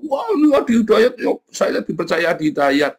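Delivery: ape the starstruck believers, a bit hammed-up; mock-adoring exclamation; mock follower. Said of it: "lalu umatnya itu".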